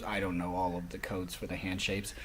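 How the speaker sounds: ordinary delivery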